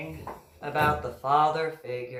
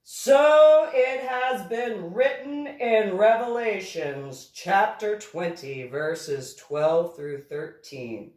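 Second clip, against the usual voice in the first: grinning; 6 semitones higher, 7 dB louder, slower than usual. A narrator's reading. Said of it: "added with a small grin".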